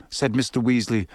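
usual speaking voice

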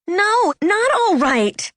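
slow, high, emphatic refusal; angry shout. A narrator's reading. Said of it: "I was aware that I was shouting".

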